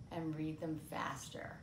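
ordinary delivery, nothing marked

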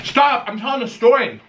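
sharp yell to stop; shouting. Real, not acted. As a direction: yell it out to cut him off. Yelling at the co-host.

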